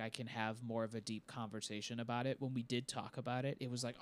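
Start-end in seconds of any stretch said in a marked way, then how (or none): none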